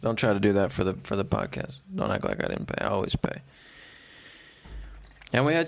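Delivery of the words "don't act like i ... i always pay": whisper. Real, not acted. Repeats the objection under his breath.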